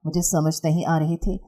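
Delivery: usual speaking voice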